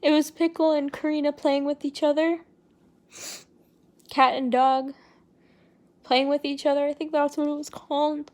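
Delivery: crying with breath catching; gasping between words while crying; catching breath midword. Real, not acted. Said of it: "I'm crying right now".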